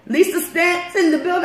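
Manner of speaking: high announcer call; hype shout-out. Retold or imitated. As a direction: announce her like a hype man. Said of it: "comp will say".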